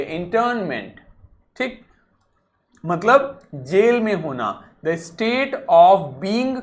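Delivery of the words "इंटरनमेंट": इंटरनमेंट with the ra barely said; light ra. Shown as a demonstration. Teacher modelling इंटरनमेंट for students, ra barely voiced. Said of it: "ना ही बोलिए".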